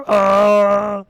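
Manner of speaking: very loud, high wookiee howl; mock creature roar at full volume; imitated Chewbacca roar. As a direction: let out a big insistent Chewbacca bellow.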